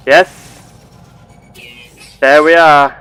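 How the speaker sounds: loud, excited cry of success; triumphant. Real, not acted. Explorer finally catching the creature in the scanner, triumphant. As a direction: exclaim it loudly, thrilled to have got it.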